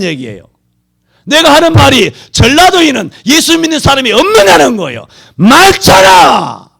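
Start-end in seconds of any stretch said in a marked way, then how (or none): 1.2-6.7 loud, high, slowing preacher's cry; impassioned sermon shout; fiery preaching. Acted. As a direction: thunder it from the pulpit. Preacher rails against vote.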